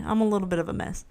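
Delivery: rushed quiet repeat; quick aside. Real, not acted. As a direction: toss it off quickly. Admits being a mess twice.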